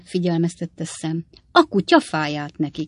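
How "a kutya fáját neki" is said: plain reading voice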